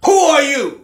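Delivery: high, loud crying shout. Acted. Preacher rebuking the congregation with a crying shout. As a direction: cry it out to the congregation.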